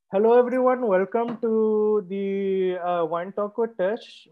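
a light put-on Indian accent, drawn out slowly; playful Indian accent. Host jokingly greets his stream viewers in a light Indian accent. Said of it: "with my Indian accent this time?".

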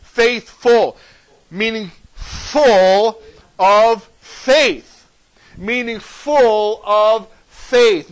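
"full of faith" very slow and loud, word by word; emphatic word breakdown. Shown as a demonstration. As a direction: punch "full" and "faith" with gaps between.

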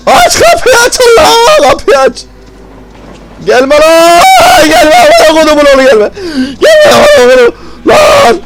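very high, full-volume frantic shrieks; panicked screaming. Real, not acted. Gamer trapped at a locked door with the monster coming, panicked screaming. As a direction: scream for the door to open and the pursuer to stay away. Chased, door will not open.

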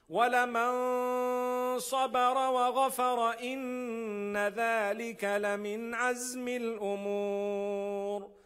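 measured, melodic recital of the verse; chanted Quranic verse; Quran recitation. Acted. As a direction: recite it like scripture.

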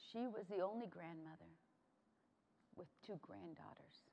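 low, sinking almost to a whisper; hushed solemnity. Real, not acted. Honoring grandma with two granddaughters in Congress, hushed and low. Moving climax about late grandmother.

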